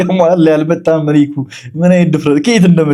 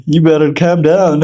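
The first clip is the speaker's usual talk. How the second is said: mock echo; much slower than usual. Streamer echoes clip line.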